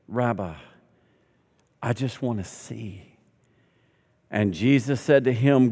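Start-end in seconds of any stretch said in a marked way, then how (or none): 0.1-3.2 quiet, slow plea; a blind beggar's soft, slow plea for sight; soft pleading. Acted. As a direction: hush it like a broken request.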